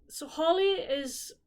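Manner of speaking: ordinary talk, nothing marked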